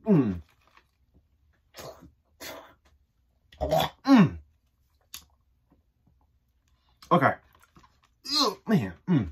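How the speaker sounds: chewing while talking; eating a snack; mouth full. Real, not acted. Seen 0: bites seaweed before, chews with hand at mouth, holds sheets.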